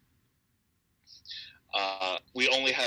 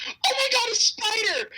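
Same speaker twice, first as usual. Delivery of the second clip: put-on high, loud girly shriek; screaming girl; 4 dB louder than usual. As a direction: shriek at the spider. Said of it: "one of the girls just goes".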